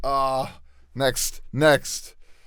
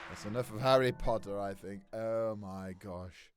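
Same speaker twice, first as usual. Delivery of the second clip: worn-out voice; 5 semitones lower, 11 dB quieter than usual. Real, not acted. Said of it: "Will my voice recover?".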